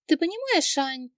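high, hesitant hedging; mumbling reluctance. A narrator's reading. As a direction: ease reluctantly into a confession. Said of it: "промямлила она".

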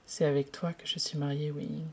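soft, low, almost whispered; tender breath. Acted. Lan Zhan tells Wei Ying gently they are married, softly whispered. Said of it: "souffla-t-il tendrement".

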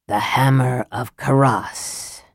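slow, drawn-out hiss on the last syllable; sibilant hiss. A narrator's reading. Raistlin teases Sturm about the hammer, hissing the end. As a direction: let Karas end in a long hiss. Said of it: "drew out the last syllable into a sibilant hiss".

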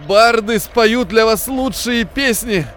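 choked, slow, high strained voice; strained wheeze. Acted. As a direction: force it out through a tight throat. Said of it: "просипел он сдавленным голосом".

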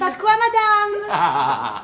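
high, drawn-out servant's reply; playing the valet. Pretend valet answers madame, mock-obliging. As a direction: put on an obliging valet, stretched out.

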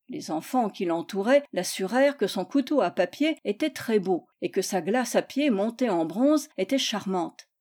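ordinary delivery

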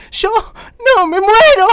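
high, loud wail of dread; horrified outcry. Acted. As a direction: cry out at the very thought. Horrified at the idea of prison.

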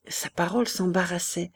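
ordinary delivery

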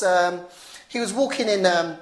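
ordinary talk, nothing marked

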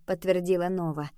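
ordinary delivery

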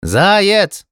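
high drawn call; shouting. A narrator's reading. Hedgehog calls to the hare, shouting. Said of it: "крикнул ёжик".

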